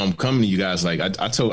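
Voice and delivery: ordinary delivery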